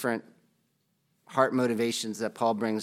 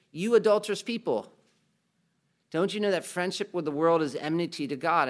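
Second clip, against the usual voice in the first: quoted rebuke; 6 semitones higher than usual. Retold or imitated. Quoting James rebuking his readers.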